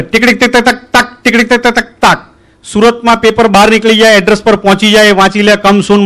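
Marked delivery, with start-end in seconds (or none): 0.0-2.2 spoken ticking like a telegraph key; telegraph clicks. Retold or imitated. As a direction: rattle it off like a typing machine. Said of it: "એ લોકો type કરે".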